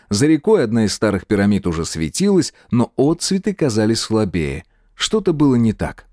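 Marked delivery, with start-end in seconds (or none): none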